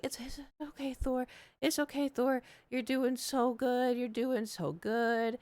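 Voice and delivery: high, slow cooing reassurance; soothing pet talk. Retold or imitated. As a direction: coo to a nervous pet, high and drawn out. Soothing her cat at the vet.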